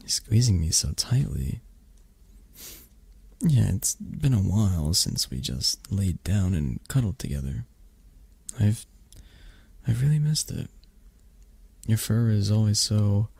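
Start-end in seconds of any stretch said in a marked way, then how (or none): none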